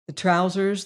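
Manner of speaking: ordinary delivery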